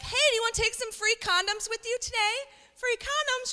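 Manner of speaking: put-on very high, cheery front-desk girl; high-pitched singsong offer, hammed-up; happy mock yell. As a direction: imitate calling across a room, without real volume. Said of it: "She yells at me across the waiting room".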